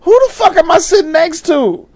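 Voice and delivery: high, disbelieving mock outrage; incredulous inner thought. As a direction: act stunned at who is beside you. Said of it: "I was like".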